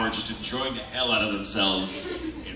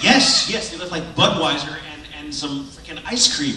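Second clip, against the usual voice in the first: excited yes; 4 semitones higher, 8 dB louder than usual. Retold or imitated. Excited reply to friend.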